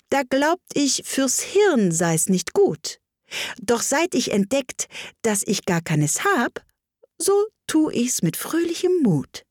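poem recitation. Acted. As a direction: recite it in a lilting, lifted voice.

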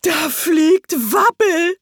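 high, loud and drawn out, slow; crowd chorus. A narrator's reading. The crowd cries out as Wabbel flies, loud and slow. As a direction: cheer it out long as a chorus. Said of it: "einen allgemeinen Chor".

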